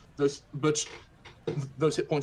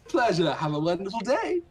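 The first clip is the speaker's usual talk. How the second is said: cheery shopkeeper; 5 semitones higher, 5 dB louder than usual. Acted. Shopkeeper sends them off.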